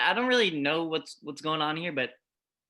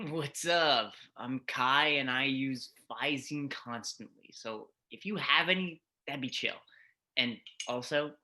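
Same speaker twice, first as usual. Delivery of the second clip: stoner voice; 7 semitones lower, 6 dB quieter than usual. Acted. Stoner character introduces himself.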